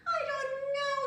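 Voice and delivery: put-on high, loud little girl's voice; Prunella. A narrator's reading. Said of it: "said Prunella".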